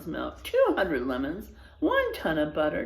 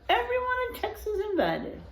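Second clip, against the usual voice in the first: announcing; 14 semitones higher than usual. Acted. Telegraph announcement.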